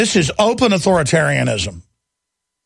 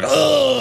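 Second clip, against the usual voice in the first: disgusted grunt; 10 semitones higher than usual. Retold or imitated. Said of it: "He's like".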